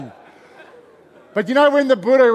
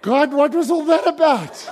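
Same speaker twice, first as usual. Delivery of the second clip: exasperated question; 6 semitones higher, 4 dB louder than usual. Retold or imitated. Bewildered question to God retold.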